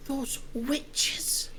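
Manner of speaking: hushed and scared; fearful whisper. Acted. Villager woman whispering fearfully about the witches. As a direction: whisper it like a secret.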